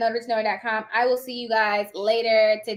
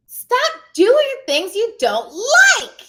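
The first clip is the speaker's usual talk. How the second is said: exasperated; 10 semitones higher, 8 dB louder, much slower than usual. Real, not acted. Exasperated urging of viewers.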